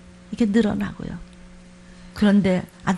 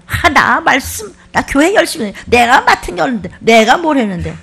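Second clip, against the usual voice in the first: indignant protest; 3 semitones higher, 7 dB louder than usual. Retold or imitated. Protesting believer mimicked.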